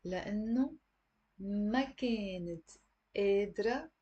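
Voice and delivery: slow reading. Shown as a demonstration. Teacher reading slowly so students can write. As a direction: read each word with pauses between. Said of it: "I'm gonna read slowly again".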